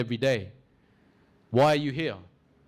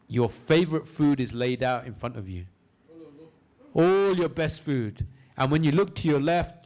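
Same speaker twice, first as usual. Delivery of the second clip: guided visualization; 2 semitones lower than usual. Acted. Leads a guided imagination exercise.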